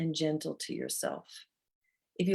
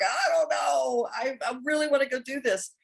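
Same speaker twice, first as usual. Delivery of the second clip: much higher, half-crying whine; whiny protest; 9 semitones higher than usual. Retold or imitated. Her ego whining to still go work out. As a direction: whine like a kid denied a treat. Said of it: "I was like".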